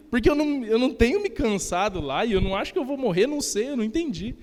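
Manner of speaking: high, loud, exasperated protest; baffled protest. Retold or imitated. Retelling his puzzled reaction to the pastor, loud and incredulous.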